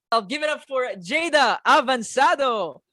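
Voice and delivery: loud, high host call-out; announcing the guest. Real, not acted. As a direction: bring her on with a big build. Host introduces guest to audience.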